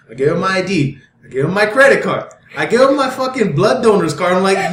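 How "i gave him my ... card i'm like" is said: ordinary talk, nothing marked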